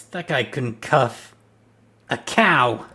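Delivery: mocking jab. Mocking a clumsy colleague.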